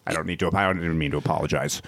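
fast, low, quiet aside; rushed mumble. Real, not acted. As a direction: mumble it quickly to yourself. Quick quiet self-correction.

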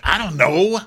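high, loud, goofy voice; dopey high voice. Retold or imitated. Mocking Austin's clueless answer in a high goofy voice. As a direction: play it dumb and high.

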